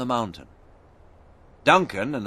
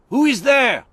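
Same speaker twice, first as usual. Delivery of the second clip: much higher, loud challenge; sentry challenge; 6 dB louder than usual. A narrator's reading. A French soldier calling into the fog, loud and high. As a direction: call out sharply into the fog.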